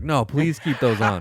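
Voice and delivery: sarcastic sergeant voice; mock polite. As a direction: taunt him sweetly. Sergeant mocking his sunglasses.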